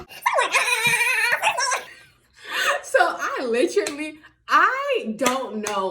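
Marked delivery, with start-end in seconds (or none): 0.5-1.8 high, repeated mock panic wail; panicked cries. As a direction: squeal in comic panic. Her panicked reaction to orders.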